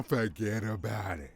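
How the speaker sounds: lightly voiced New Jersey wiseguy brushing it off; a hint of a gruff New Jersey mobster; mock New Jersey dismissal; New Jersey tough guy. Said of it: "an angry fat man from Jersey".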